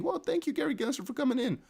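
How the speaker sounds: high, soft imitation of a grateful voice; mimicking someone's polite thanks in falsetto-like pitch; mock thank-you. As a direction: pitch it way up, lightly and softly.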